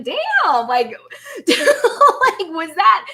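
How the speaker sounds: high, laughing disbelief; stunned. Retold or imitated. Streamer retelling her shock at the read, laughing. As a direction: gasp it out in amused shock. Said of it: "step back and be like".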